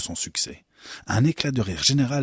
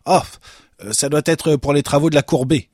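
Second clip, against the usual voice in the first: hesitant start, then higher and louder; stammering; 7 semitones higher, 8 dB louder than usual. A narrator's reading. Head guard explaining to the director, stammering. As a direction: stammer out an excuse. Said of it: "balbutia le gardien-chef".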